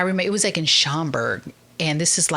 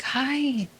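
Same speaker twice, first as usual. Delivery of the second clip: soft prophetic whisper; 5 semitones higher, 6 dB quieter, slower than usual. Retold or imitated. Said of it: "and she's like".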